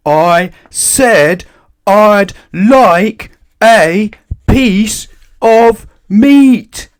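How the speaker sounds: slow, drawn-out high roar. A narrator's reading. Mister Noisy roars his meat order at the butcher. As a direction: roar each word out slowly. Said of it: "roared Mister Noisy".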